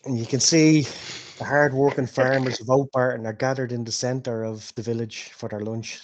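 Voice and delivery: ordinary talk, nothing marked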